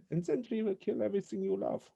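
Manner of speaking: high, soft villain threat; hammed-up whispery accented villain; mock menacing whisper. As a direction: threaten softly in a put-on high voice. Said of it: "I think it's the whisper".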